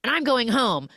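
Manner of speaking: high, indignant mock protest; playfully outraged denial. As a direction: huff it out, offended. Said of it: "And she's like".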